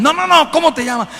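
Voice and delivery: high-pitched, insistent retold demand; impatient questioning. Exorcist rejects the man's answer, impatient. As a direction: cut in and press for the name, pitch high.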